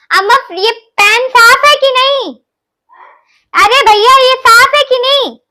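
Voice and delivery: high, loud call to mom in another room; calling out to mom loudly from the kitchen. Real, not acted. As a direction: shout the question across the house. Calling out to mom across house.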